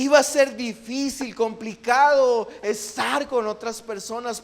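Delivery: high-pitched, emphatic sermon voice; impassioned preaching. Real, not acted. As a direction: press the message on the congregation. Preaches at raised pitch to congregation.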